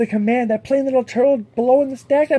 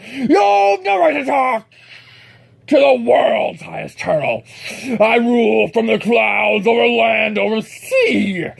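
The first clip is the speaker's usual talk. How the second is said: ranting turtle king; 7 dB louder, slower than usual. Acted. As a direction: lord it over him from the clouds. Yertle ranting at Mac.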